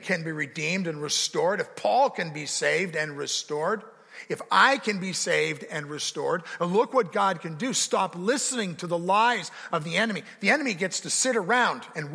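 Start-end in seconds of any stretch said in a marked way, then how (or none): none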